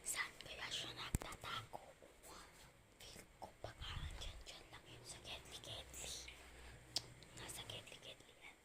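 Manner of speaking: hushed whisper while searching; whispering while hiding. Real, not acted. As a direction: whisper so they can't hear. (pabulong habang naghahanap)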